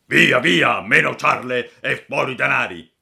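loud menacing robber bark; threatening shout. Acted. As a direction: shout the demand to scare him. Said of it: "gridavano minacciosamente".

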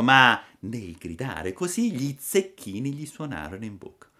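usual speaking voice

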